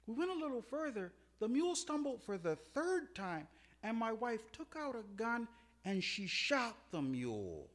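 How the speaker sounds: ordinary talk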